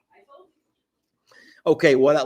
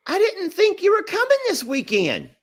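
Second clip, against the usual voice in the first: very high-pitched imitation of a puzzled mom; mock surprised mother; mom's voice. Voicing confused mother in story.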